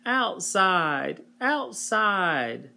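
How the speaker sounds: high, nagging whine; whining child. Acted. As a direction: drag out each outside. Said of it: "whined Eve".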